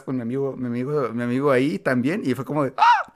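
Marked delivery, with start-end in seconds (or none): 2.8-3.1 a very high, loud mock shriek of shock; shocked gasp. Teacher catching the proposal, shrieking in shock.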